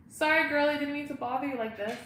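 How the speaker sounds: high-pitched apology; sweet pet talk. Real, not acted. To the dog she called over, sweet apology.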